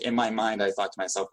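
usual speaking voice

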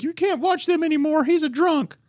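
very high, shrill-free mock Australian parent; attempted Australian accent. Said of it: "I can't do an Australian accent".

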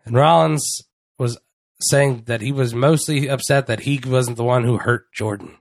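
ordinary talk, nothing marked

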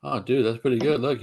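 usual speaking voice

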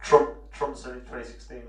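robotic mic-distorted voice; Robocop voice. Real, not acted. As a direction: sound like a robot cop. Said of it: "Robocop voice".

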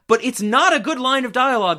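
loud, high burst of real frustration; infuriated. Real, not acted. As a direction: insist hotly, raising the pitch. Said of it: "This infuriates me so much".

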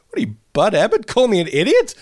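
[name] firing back at his friend, hammed-up outrage; indignant retort. A pal ribbing [name], he snaps back with mock indignation.

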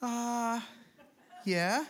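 high, drawn-out reluctant answer; stall, then admit it as a question; mock sheepish admission; hesitant husband. Noah reluctantly answers his wife.

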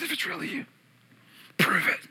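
whispered challenge; hushed doubt. Acted. Preacher confessing his own questioning of Jesus, whispered.

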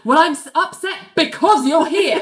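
high-pitched, exasperated retort; mock exasperation. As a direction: act out being fed up with an intruder. Nell upset, tells Elaine to leave.

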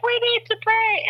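high-pitched eager blurt; urgent retold plea. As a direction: burst out high, as if you just ran up. Retold rushing up to pray.

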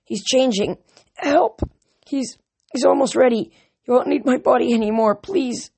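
high, desperate frightened pleading; Luke's panicked voice. A narrator's reading. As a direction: beg for help in panic. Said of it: "Luke's voice".

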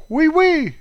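very high, piping oui oui; jokey high-pitched French yes; squeaky French catchphrase. Pretend. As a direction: pipe it up high, playfully.

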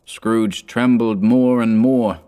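ordinary delivery, nothing marked

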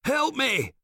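high desperate plea; cry for help. A narrator's reading. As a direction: call for help in terror. Soldier cries for help.

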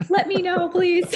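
high, loud laughing plea. Real, not acted. Host laughing as she begs her new friend to visit.

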